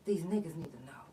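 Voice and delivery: unvoiced hushed whisper; secretive quiet whisper to a friend; whispered aside. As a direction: lean in and whisper it. Confiding aside in quote.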